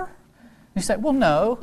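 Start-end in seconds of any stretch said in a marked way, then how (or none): 1.0-1.6 higher-pitched imitated child; sheepish child's denial. Classmate answers teacher about the heifer, high-voiced denial. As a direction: lift the voice into a reluctant kid's admission.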